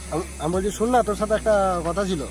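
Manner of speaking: ordinary delivery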